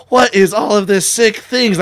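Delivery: hammed-up outrage; mock shock. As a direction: act appalled at what is on the shelves. Mock shock in the store retold.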